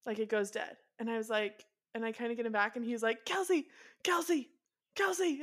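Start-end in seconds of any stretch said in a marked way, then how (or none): 3.2-5.4 insistent retold call, higher pitched; calling her name. Zach trying to reach Kelsey over FaceTime, higher-pitched calling.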